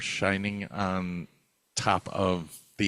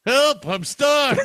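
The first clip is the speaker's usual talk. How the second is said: much higher, louder tiny trapped voice; muffled cry for help; 9 dB louder, slower than usual. Pretend. Joking about Gorlock, a high tiny trapped voice calling for help. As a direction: squeak for help from somewhere stuck. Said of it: "you'll hear something like".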